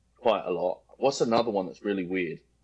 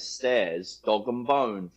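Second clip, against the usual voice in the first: usual speaking voice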